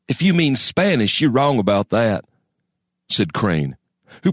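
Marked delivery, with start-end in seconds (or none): 0.1-2.3 smug retort. A narrator's reading. Crane retorts.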